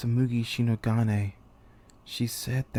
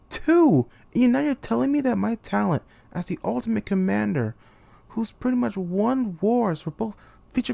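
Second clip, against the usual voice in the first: furious outburst; 8 semitones higher than usual. Acted. Commander confronting the mastermind who lied, furious shout. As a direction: hurl every word at the liar who betrayed you.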